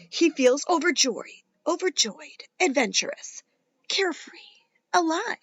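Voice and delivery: put-on lively read-aloud for kids; animated children's story reading; story narration. A narrator's reading. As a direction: read it like a bedtime picture book. Reads picture book to children.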